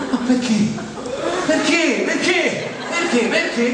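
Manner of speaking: high-pitched, insistent repeated why; comic high-pitched pleading; mock protest. Repeats a protesting question in the bit.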